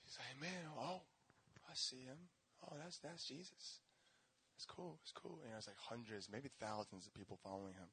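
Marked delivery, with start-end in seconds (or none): 0.4-5.4 a hint of a shy Zacchaeus.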